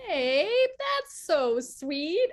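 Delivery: touched. Retold or imitated. Touched reply to his compliment.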